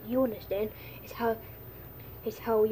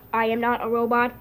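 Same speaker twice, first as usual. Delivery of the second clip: ordinary talk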